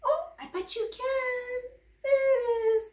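high, bright praise after a gasp; gasp, then a high happy cheer to the baby; delighted gasp. Acted. Praising baby finding mouth.

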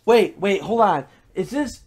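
usual speaking voice

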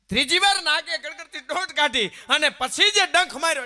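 loud, high climactic telling; loud climax. Acted. Storyteller to the crowd, loud climactic strike. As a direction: build it to the big strike.

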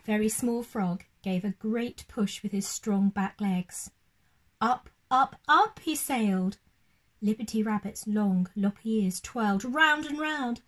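put-on gentle storybook narration for children; lively read-aloud voice for little ones; bedtime story reading. A narrator's reading. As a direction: read it like a bedtime picture book.

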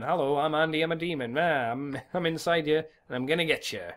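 ordinary talk

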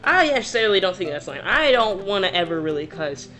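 usual speaking voice

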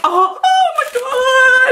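very high, overwhelmed shriek; ecstatic squeal. Real, not acted. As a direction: squeal in real disbelief and joy. Finds the longed-for gift.

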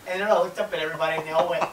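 usual speaking voice

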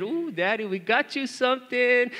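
put-on high, drawn-out singsong child voice; excited little girl. Daughter teasing Dad about his gift, excited imitation. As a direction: imitate a child bursting with news. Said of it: "Ava was so excited".

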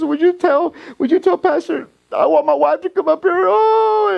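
high-pitched, hammed-up distraught begging; imitated tearful plea. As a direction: plead high, rising into a wailed "oh". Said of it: "the pastor starts crying".

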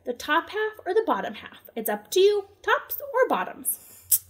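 storyteller playing a cunning hare; sly Hare voice. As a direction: tempt him like a sly rabbit.

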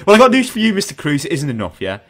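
indignant mock address to an absent celebrity; mock telling-off. Podcaster telling off absent movie star over lazy answers, mock indignant.

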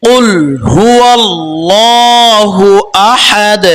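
কুল with a bouncing ক্বাফ, slow chanted; tajweed recitation. Shown as a demonstration. Teacher recites the correct verse to students, slow chant. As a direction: recite কুল হুয়াল্লাহু আহাদ drawn out, qalqala on the ক্বাফ. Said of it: "কুল, এখানে আমরা কলকলা করব".

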